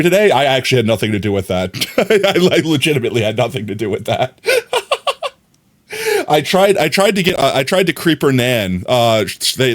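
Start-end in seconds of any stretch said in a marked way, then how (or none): 4.5-5.3 high squeaky giggle; squeaky laugh after the denial. Real, not acted.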